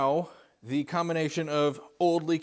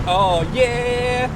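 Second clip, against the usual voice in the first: excited shout; 9 semitones higher, 6 dB louder than usual. Real, not acted. Excited exclamation starting drive.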